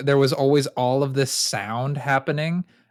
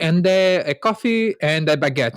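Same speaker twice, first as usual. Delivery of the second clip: louder mock French accent; French woman's accent; 3 semitones higher, 3 dB louder than usual. French woman finishing her bakery order, mock French accent. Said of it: "it was a French woman".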